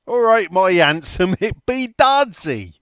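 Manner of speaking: put-on hammy Welsh accent, slowing at the end. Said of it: "where you did the Welsh voice".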